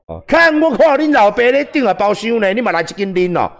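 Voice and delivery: mocking Taiwanese greeting shouted high. Shown as a demonstration. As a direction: shout a nasty hello across the room. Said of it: "类似这样子".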